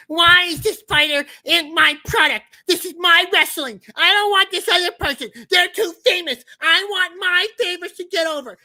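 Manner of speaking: high, loud whiny fan rant; [name] complainer voice. Acted. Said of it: "that's my complainer voice".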